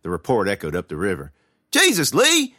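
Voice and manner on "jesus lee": high, loud shout of protest. A narrator's reading. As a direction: yell it across the water at your brother. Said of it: "I heard Mitch shout".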